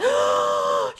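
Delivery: sharp loud excited gasp; big thrilled gasp. Real, not acted. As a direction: suck in air in delight. Excited reaction to sims meeting.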